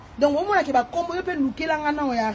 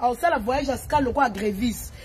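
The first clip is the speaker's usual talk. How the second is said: normal talking voice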